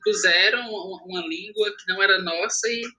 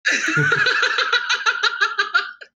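shrieking laugh, real; real laughter; high laughing burst; 13 semitones higher than usual. Real, not acted.

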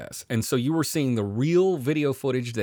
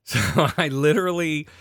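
ordinary delivery

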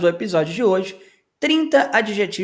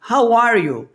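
friendly greeting question; 4 semitones higher, 5 dB louder than usual. Retold or imitated. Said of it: "aquela famosa perguntinha".

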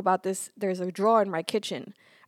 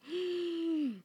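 mock dramatic gasp; 8 semitones higher, 8 dB quieter than usual. Reacting to son's complaint.

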